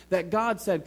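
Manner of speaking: ordinary delivery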